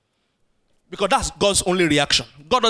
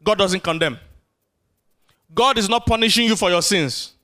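ordinary delivery

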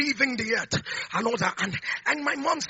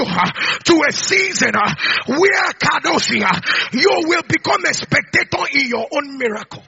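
louder, higher preaching with shouted peaks; prophesy over the crowd, building; fiery preaching; 5 semitones higher, 8 dB louder than usual. Acted. Preacher prophesies overflow to the church: rising fiery shout.

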